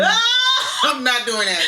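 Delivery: high, loud laughing cry of refusal; real laughing shout of no; laughing protest. Real, not acted. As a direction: squeal and laugh it off, then refuse. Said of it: "My voice is so hoarse".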